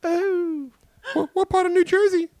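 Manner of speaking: put-on very high, loud, slow falsetto old lady. Host teases co-host's mother in a high slow old-lady falsetto. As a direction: croon at her like a doting old biddy. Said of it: "do Mumsy".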